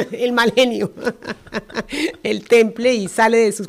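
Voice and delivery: amused, laughing as the words come out. Real, not acted. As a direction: talk through a laugh. Laughs while softening a criticism.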